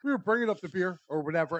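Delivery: usual speaking voice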